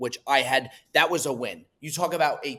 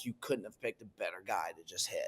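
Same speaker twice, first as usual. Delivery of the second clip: inner thought; 7 semitones lower, 13 dB quieter than usual. Retold or imitated. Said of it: "my first thought was".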